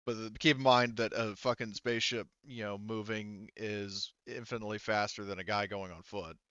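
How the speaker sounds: ordinary delivery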